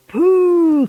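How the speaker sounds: loud, high, long pooh; disgusted cry. A narrator's reading. Witches reacting to the stink of children, loud cry. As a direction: cry out like a revolted crowd. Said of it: "cried the audience".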